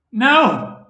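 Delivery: sudden loud, high cry of denial; shout. A narrator's reading. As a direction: hurl the no out at full voice. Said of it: "he shouted in the corpse's face".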